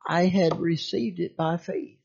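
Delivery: ordinary delivery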